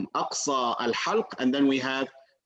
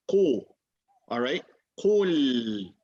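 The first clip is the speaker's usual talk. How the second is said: normal talking voice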